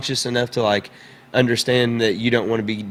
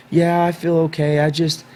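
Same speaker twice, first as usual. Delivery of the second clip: retold child; 5 semitones higher than usual. Child's answer voiced in story.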